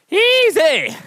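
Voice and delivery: high, drawn-out cowboy call. Shown as a demonstration. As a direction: sing it out like a rodeo cowboy. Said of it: "God, I sound like a cowboy".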